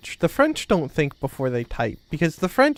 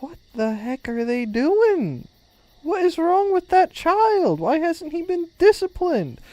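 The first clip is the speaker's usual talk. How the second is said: high-pitched, hammed-up disbelief; exaggerated indignant onlooker; mock appalled; 11 semitones higher than usual. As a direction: act scandalized, pitch climbing way up. Acts out appalled onlooker at child.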